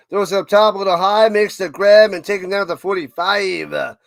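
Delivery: loud excited game call; play-by-play call. Acted. Calling the pass for viewers, loud and excited. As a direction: call the catch like it's live on air.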